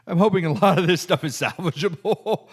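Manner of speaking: laughing through the words. Real, not acted. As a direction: laugh at the bad footage. Laughs over damaged footage.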